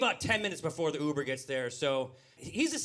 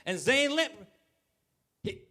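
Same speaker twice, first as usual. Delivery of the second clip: higher-pitched mock-excited announcement, slowing at the end; admiring onlookers; 7 semitones higher, 3 dB louder than usual. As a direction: hail two stars coming down the street. Imagined passers-by announcing the two friends.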